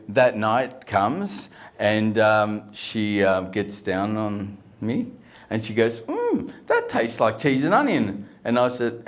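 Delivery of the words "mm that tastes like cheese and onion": high tasting hum, then higher-pitched verdict; wife's voice. Acted. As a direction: imitate a wife sampling a flavour. Wife tasting, acted in joke.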